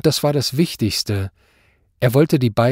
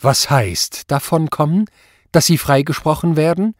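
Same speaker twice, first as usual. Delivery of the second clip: higher, rising interrogation; scornful judge's raised question; incredulous questioning; 5 semitones higher, 5 dB louder than usual. A narrator's reading. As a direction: challenge him in disbelief.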